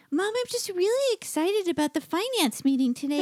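a tiny eager Chihuahua gushing; cartoon dog. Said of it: "Hazel sounds like this".

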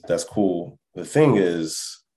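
ordinary talk

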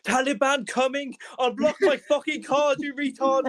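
high, loud, drawn-out panicked rant; frantic yelling. Acted. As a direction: yell down the phone in exaggerated outrage. Frantic call to bank in crisis.

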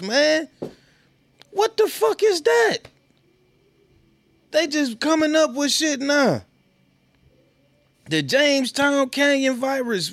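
high-pitched comic disbelief; incredulous rant. Real, not acted. Host to viewers on an unheard-of virus, high incredulous rant. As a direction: voice shooting way up in exasperation.

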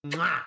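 loud smacking Italian chef kiss; chef's kiss. Pretend. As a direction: kiss your fingertips like a chef. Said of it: "and do it with me".

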